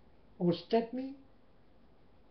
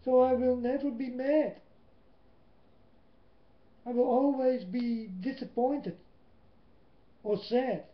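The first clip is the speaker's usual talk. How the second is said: higher and louder, proclaiming never; firm declaration; 4 semitones higher, 3 dB louder than usual. Real, not acted. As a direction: declare it boldly to the church. Declares vow loudly to congregation.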